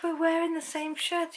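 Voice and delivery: a hint of disbelief; mock exasperated. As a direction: pitch it up as if it were obvious. Retold exasperated reply to husband.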